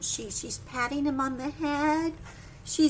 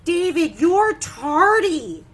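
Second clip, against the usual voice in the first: loud, slow scolding; a strict teacher, loud and slow; stern teacher; 9 dB louder, slower than usual. Acted. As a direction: tell him off for being late. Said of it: "That means he's late".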